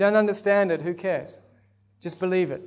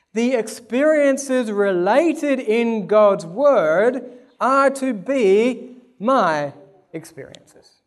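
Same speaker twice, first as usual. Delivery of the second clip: slow, loud and higher; preaching; 5 semitones higher, 5 dB louder, slower than usual. Acted. Preacher building his point to the church, slow and loud. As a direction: build it steadily.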